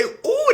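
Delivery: high, loud laughing ooh; mock distress cry. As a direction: yelp it out like someone just got hit. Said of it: "they in distress".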